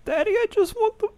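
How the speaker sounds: put-on childish whine. Friend whining to his prank-calling pal like a small child.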